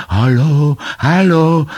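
repeated high, loud hailing; shout. A narrator's reading. Son joining his mother's call to castaways, high shout. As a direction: holler to be heard far away. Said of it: "krzyknął też Muminek".

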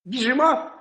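a keen hare ready to race; hare asking. Acted. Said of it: "питає заєць".

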